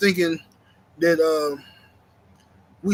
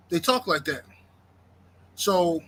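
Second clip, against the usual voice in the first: usual speaking voice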